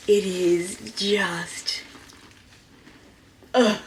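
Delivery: slow breathless whisper; slow hushed awe; awed whisper. Real, not acted. Overwhelmed by the leather.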